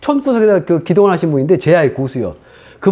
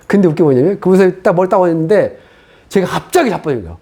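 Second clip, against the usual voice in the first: ordinary talk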